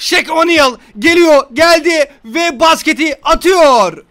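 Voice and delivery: loud, drawn-out play-by-play; mock sports commentary. Gamer commentating his own basketball shot, hammed-up commentary. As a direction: build up the throw like a commentator, slowly.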